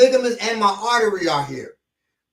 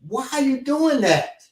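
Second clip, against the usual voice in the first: higher-pitched questioning of an attacker; acted challenge; 8 semitones higher than usual. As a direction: ask it high, drawing out the question. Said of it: "you say".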